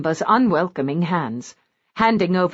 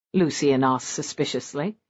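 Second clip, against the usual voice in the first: ordinary delivery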